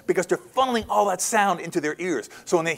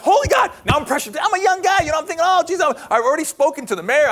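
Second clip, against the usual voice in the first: nervous excitement; 10 semitones higher, 4 dB louder than usual. Retold or imitated. Young man panicking about big presentation.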